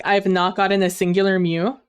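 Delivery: usual speaking voice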